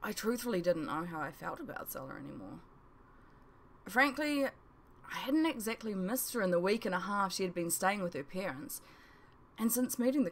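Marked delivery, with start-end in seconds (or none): none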